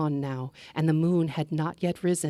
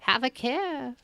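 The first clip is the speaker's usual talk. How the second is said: put-on old woman in green; 7 semitones higher than usual. Old woman warns traveler.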